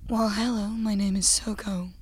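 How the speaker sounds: breathy, husky sexy whisper. Pretend. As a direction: purr it out seductively, in a whisper. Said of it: "do your sexy voice".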